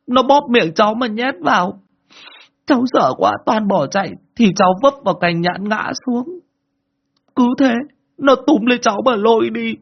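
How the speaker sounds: put-on high, loud frightened child's sobbing account; a terrified boy crying through his story; crying harder. Boy tells uncle about the ghost woman, crying loud and high. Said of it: "anh Quang lại càng khóc to hơn".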